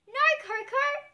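loud high no; children yelling together in alarm; shouting. A narrator's reading. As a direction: shout to stop a friend. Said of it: "shout Bluey and Snickers".

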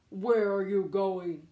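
slow, loud wolf call; shouted wolf. Acted. Wolf shouting at the gingerbread man, slow. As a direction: call out like a big bad wolf. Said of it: "shouted the wolf".

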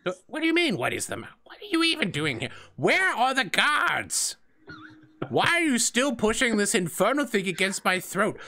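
put-on high, flustered old man voice; outraged old assistant. The old assistant protests the staff at his throat. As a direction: splutter in protest.

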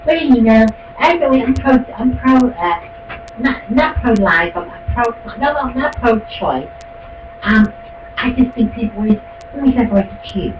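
high, dithering mock voice of a wishy-washy churchgoer; mocking waffler. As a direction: stammer it out like a spineless fence-sitter. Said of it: "That's how you say it".

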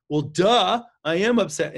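loud, snotty mock-teen "duh"; sarcastic teen comeback. Parent imitating a teen answering back, loud and snotty. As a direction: toss it back like an eye-rolling kid.

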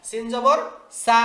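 ordinary delivery

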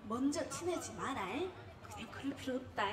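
low-key, hushed motherly caution; mom's warning. Retold or imitated. Mother to daughter before she leaves for Seoul, hushed warning. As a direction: imitate mom advising, soft and subdued. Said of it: "엄마가 나 서울 올라오기 전에 했던 말".

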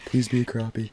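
normal talking voice

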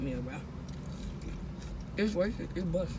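speaking between bites; talking while chewing fries. Real, not acted. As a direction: mouth partly full of food. Seen 2: Fries held from start; put into mouth 2.6-4.2, then keeps talking.

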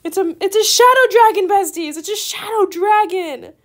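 loud, fast, high-pitched yelling; thrilled shouting to the besties; excited shout. Real, not acted. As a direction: shout it out, overjoyed. Shadow dragon arrives in trade.

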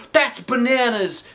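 high, slow put-on voice; teacher character voice. A narrator's reading. Teacher scoffing at the plan, high slow voice.

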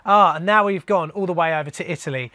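ordinary talk, nothing marked

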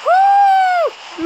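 very high, loud woo; excited whoop. Real, not acted. Gamer whooping at his stream, excited. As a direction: let out a real cheer. Cheering in game.

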